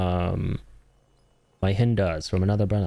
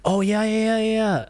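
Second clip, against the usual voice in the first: sudden recall; 11 semitones higher than usual. Real, not acted. Suddenly remembering.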